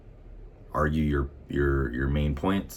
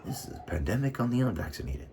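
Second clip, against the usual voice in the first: higher, faster mock of Biden; 7 semitones higher, 5 dB quieter, faster than usual. Mocking Biden's lockdown line, higher and quicker. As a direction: parrot him with a sneer. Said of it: "Biden said the same shit".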